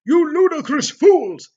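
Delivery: loud, slow, high furious roar; angry shout. Acted. Headmaster screams at the students, slow and enraged. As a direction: bellow at them like a furious headmaster. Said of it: "kept shouting at us angrily".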